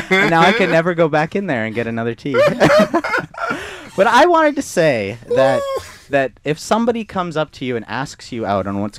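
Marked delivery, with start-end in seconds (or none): 0.1-6.3 laughing embarrassed. Real, not acted. Embarrassed laughing at own story.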